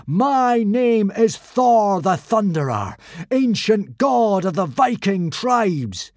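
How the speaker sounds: slow, loud and high proclamation; booming god voice. Acted. Boy posing as Thor booming to the crowd. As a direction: announce yourself as a thundering god. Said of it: "in a voice he had never used before".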